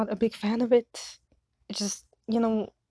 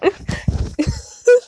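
high loud laughing fit; 14 semitones higher, 9 dB louder than usual. Real, not acted. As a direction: lose it completely. Breaks character laughing.

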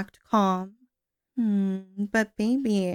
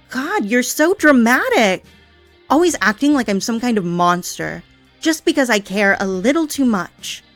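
louder and louder, aggrieved and sarcastic; rising frustrated rant; 5 dB louder than usual. Acted. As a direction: spiral up into a bitter, hurt outburst. Yandere rants at tied captive.

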